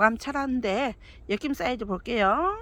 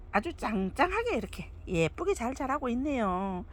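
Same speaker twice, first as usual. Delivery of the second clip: ordinary delivery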